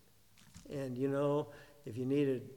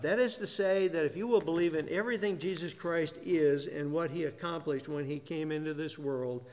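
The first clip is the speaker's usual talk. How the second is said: ordinary talk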